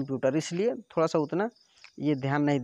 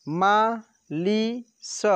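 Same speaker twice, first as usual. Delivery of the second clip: very slow syllables; 3 semitones higher, much slower than usual. Shown as a demonstration. Teacher sounding out syllables for pupils, very slow. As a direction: spell it out slowly, syllable by syllable. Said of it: "direct आपको बोलना है कि".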